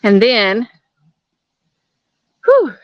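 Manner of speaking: winded from the uphill walk; out of breath. Real, not acted. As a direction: talk while catching your breath. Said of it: "sorry for breathing hard".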